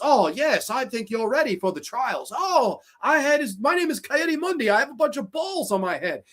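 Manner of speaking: high, sing-song mock council voice; mocked Jedi Masters. Reviewer mocking the Jedi council sitting around, high silly voice. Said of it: "they're sitting around these rooms".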